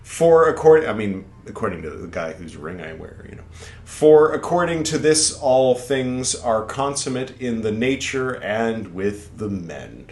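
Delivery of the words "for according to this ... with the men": slow, higher and louder grand recitation; solemn slow reading. Acted. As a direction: declaim it like prophecy. Reads a quote.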